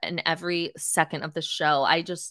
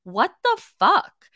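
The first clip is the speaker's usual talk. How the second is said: drawn-out, higher, louder what the fuck; retold yell; 8 semitones higher, 6 dB louder, much slower than usual. Wendy yelling back at Mia after the drink, mock outraged yell. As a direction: stretch it out in outraged disbelief. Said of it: "she's yelling back".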